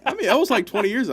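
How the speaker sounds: high, loud disbelief; can't believe how long ago; surprised. Real, not acted. Disbelief at time passed.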